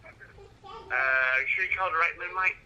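usual speaking voice

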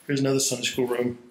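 ordinary talk

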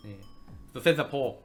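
ordinary talk, nothing marked

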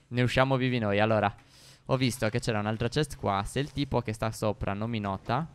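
low-pitched and soft; stealthy hush. Real, not acted. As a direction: keep it down so the enemy won't notice. Sneaking, hopes not to be noticed.

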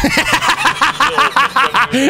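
high, cackling laughter through the words; laughing hard. Real, not acted. Streamer mocking the goofy blue-shirt player, laughing. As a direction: laugh while you mock him.

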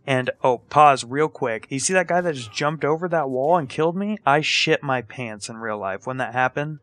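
ordinary delivery

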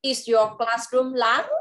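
slow, "large" lifted at the end; rising question tune. Shown as a demonstration. Teacher modelling the question for the child. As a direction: ask it slowly, rising on "large". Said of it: "con sẽ lên giọng như vậy ha".

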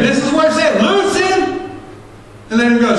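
calling the congregation to freedom; preaching shout. Acted. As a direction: lift it to a full preaching shout.

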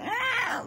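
high, soft mock baby squeal; imitated baby fuss. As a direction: imitate a baby wanting to be picked up. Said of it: "she's like".

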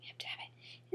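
breathy whispered excitement; whispered gush. Retold or imitated. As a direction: whisper it like a secret want. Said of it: "When I saw it, I was like".

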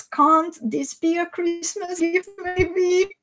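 ordinary talk

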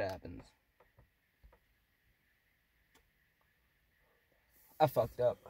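talking around a cigarette; cigarette in mouth. Real, not acted. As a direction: lips closing on cigarette. Seen 2: Cigarette reaches lips near end of words, stays during inhale.

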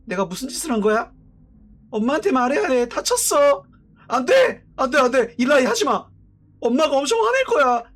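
put-on a frightened kid begging not to tell; panicked boy.

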